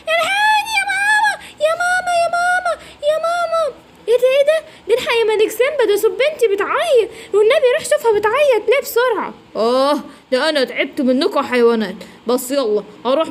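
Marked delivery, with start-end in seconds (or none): 0.1-3.8 very high wailing sobs; crying for mama. Acted. الزرافة تبكي وتستنجد بأمها. 4.1-9.3 high, urgent alarmed voice; alarmed squawk. Acted. الببغان يستنجد بسيمبا بفزع.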